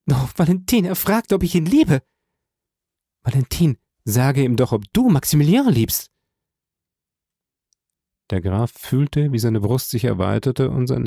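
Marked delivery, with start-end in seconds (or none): 0.1-6.1 high, excited appeal; impassioned, high woman's voice; overwhelmed young woman. A narrator's reading. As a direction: appeal to a friend in amazement, pitched high.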